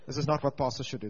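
ordinary delivery

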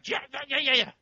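high, rapid-fire nagging imitation; mock nagging. Husband imitating his wife in the car, high mock nagging. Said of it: "She just go louder and harder".

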